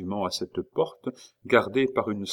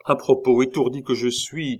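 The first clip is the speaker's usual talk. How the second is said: raised, louder sudden afterthought; calling after someone; 5 semitones higher, 6 dB louder than usual. A narrator's reading. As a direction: call after someone as you suddenly remember. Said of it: "cria l'officier en courant après Chicot". (rappel lancé à voix haute)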